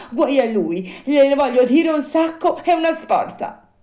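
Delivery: high, loud puppet wail; lamenting Pinocchio. Acted. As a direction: pour out remorse, building to indignation. Narrator voices remorseful, indignant Pinocchio.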